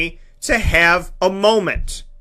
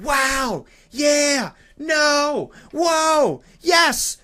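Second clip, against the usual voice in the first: put-on excited kid; 7 semitones higher, much slower than usual. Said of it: "the kid needs to be like".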